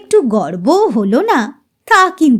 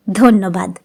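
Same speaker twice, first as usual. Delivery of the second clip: ordinary delivery, nothing marked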